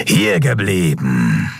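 slow, drawn-out growled order; growling leader. A narrator's reading. The leader halts the fugitives, growling. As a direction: growl it slowly, menacing. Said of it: "brummte ihr Anführer".

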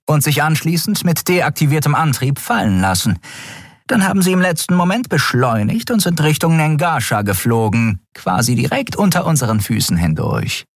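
höhere, militärisch knappe Meldestimme; sachliche Offizierin. A narrator's reading. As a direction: Meldung an den Vorgesetzten. Shia erklärt. (erste Offizierin meldet dem Admiral sachlich, höher)

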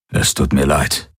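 lightly voiced low commander; a hint of a grave military leader; sorry commander. A narrator's reading. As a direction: offer low, sombre condolence. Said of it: "sagte der Feldherr".

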